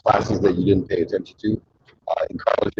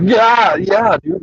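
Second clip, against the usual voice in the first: high, loud imitation of an excited dude; mock meathead enthusiasm, loud and high; 13 semitones higher, 6 dB louder, much slower than usual. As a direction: whoop it like a thrilled meathead. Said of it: "there's some dipshit meathead comes over".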